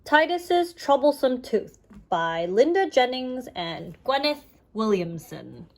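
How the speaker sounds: ordinary delivery, nothing marked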